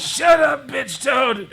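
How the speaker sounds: loud, high mock-angry shout; shouted retort. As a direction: yell back at the heckler.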